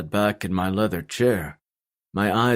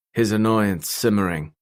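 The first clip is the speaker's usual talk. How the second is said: ordinary delivery, nothing marked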